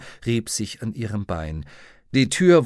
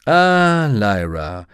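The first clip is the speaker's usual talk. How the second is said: welcoming rector; 7 dB louder, much slower than usual. A narrator's reading. The rector greeting Lyra as she arrives, welcoming. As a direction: greet an expected guest expansively.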